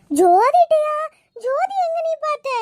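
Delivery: high, loud disbelieving questions; startled. Acted. Mother learning Jothi brought the chain, startled. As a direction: blurt it out in shock.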